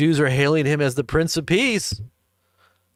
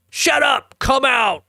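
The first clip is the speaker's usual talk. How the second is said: high, loud shout of command; shouted exorcism command; 4 dB louder, slower than usual. Acted. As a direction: order the demons out. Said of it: "And what did Jesus say?".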